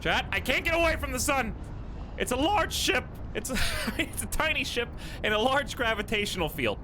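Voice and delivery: panicking gamer. Real, not acted. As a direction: shriek it as the ship falls. Ship pulled into the sun.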